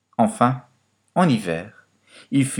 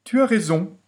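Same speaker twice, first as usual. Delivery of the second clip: higher-pitched storybook fox; 12 semitones higher than usual. A narrator's reading. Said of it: "répondit le renard".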